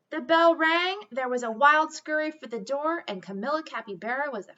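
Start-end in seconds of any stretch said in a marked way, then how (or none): none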